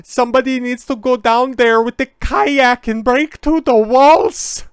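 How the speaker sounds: high, loud, frantic mock plea; mock meltdown. Mocking a singer's on-air meltdown, high and loud. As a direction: shriek it like an on-air breakdown. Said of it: "if you guys haven't seen that meltdown".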